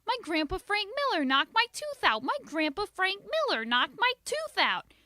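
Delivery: put-on high loud child's wail of alarm; girl shouting that grandpa knocked her tooth out; panicked shouting. A narrator's reading. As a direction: yell it running all over. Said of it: "I shouted".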